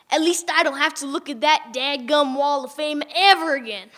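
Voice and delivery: ordinary delivery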